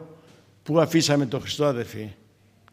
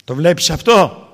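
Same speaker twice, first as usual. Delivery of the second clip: louder, slow, pointed question; a touch of God rebuking the preacher; God's stern rebuke; 4 semitones higher, 9 dB louder, slower than usual. Retold or imitated. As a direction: drive home the question with weight. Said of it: "Μου λέει ο Θεός".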